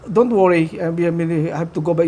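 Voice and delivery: ordinary talk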